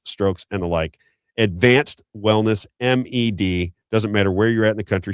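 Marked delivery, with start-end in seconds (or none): 1.4-3.7 drawn out, every letter spaced; slow brand read. Real, not acted. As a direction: let the name sink in slowly. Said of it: "slow spelled-out brand name".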